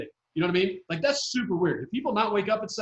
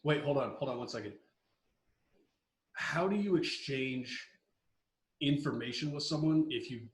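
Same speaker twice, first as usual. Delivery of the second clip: hushed aside; 5 semitones lower, 10 dB quieter than usual. Real, not acted. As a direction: drop to a near hush. Hushed conspiratorial question.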